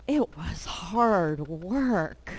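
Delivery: slow, drawn-out admission; lightly weary Toad. Acted. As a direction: stretch it out with acted effort. Toad admits the labour.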